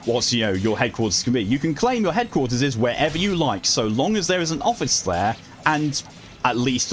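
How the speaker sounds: normal talking voice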